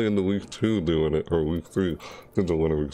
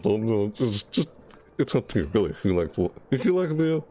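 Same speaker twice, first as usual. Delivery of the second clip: ordinary talk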